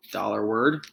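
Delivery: usual speaking voice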